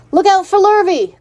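high, loud warning cry; called warning. A narrator's reading. Cows warning the pig of danger, high loud call. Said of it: "called the cows".

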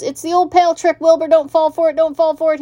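high, urgent goose voice; goose warning. A narrator's reading. Goose warning the pig about a trick, high urgent pleading. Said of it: "said the goose".

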